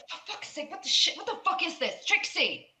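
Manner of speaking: high, alarmed yelling; scared outburst. Acted. Frightened man shouting for Trixie in a standoff, panicked. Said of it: "He looks very scared".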